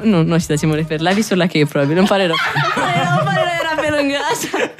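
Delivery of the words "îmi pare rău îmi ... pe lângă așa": high-pitched, laughing through the words; laughing apology. Real, not acted. Host laughs at her own mix-up while apologising. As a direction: giggle out the apology, embarrassed.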